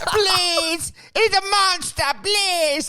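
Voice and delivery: high, hammed-up wailing; mock crying plea. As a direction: beg for rescue in a put-on tearful cry. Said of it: "he's crying".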